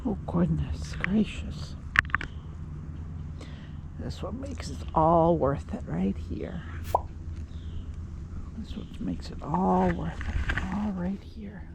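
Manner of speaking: tender. Real, not acted. Tender moment with cat.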